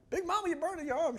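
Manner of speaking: high-pitched mock alarm; high, put-on cry of concern; alarmed movie character.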